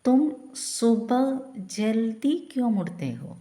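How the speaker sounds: slow, careful dictation; slow model sentence. Shown as a demonstration. Teacher modelling the Hindi question slowly for learners. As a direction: spell it out clearly. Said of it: "എങ്ങനെ ചോദിക്കുക?".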